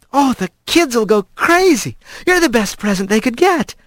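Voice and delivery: bubbling with pleasure, pitch up; delighted. A narrator's reading. Kylie leading Rat to the children, delighted.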